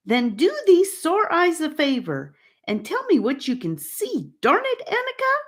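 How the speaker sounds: high, frustrated snap at friends; angry outburst. A narrator's reading. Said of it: "suddenly feeling angry with his companions".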